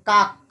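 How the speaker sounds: কাক cut off hard on the last k; stopped final sound. Shown as a demonstration. Teacher stressing the closing consonant, breath stopped. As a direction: close কাক firmly on its final k. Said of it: "ধ্বনির উচ্চারণটাকে আমরা প্রাধান্য দিচ্ছি".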